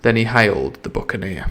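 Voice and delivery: ordinary delivery, nothing marked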